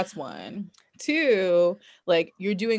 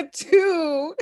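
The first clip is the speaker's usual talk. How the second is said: high laugh breaking through; laughing hard mid-story; 12 semitones higher, 5 dB louder than usual. Real, not acted. Laughing while counting points.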